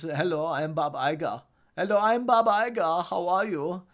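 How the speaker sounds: put-on German accent; Bob Iger. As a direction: do a German accent, rising on the repeat. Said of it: "has a German accent".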